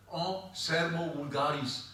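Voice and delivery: «sermo vulgaris» said slowly, each syllable set apart; Latin term. Shown as a demonstration. Lecturer presenting the Latin term «sermo vulgaris» to the class. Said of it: "Sermo vulgaris veut dire en latin".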